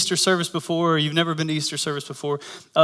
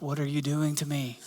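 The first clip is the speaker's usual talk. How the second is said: much softer, retold aside; hushed exasperation; 2 semitones lower, 10 dB quieter than usual. Husband turns to wife during employee's explanation, hushed exasperation. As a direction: quietly plead with your partner in mock despair.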